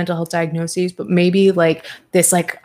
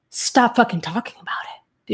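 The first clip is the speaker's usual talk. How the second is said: fed-up whisper under the breath; exasperated whisper; 3 semitones higher than usual. Real, not acted. Venting to viewers about Gabby, exasperated whisper. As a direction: whisper it like you're done with her.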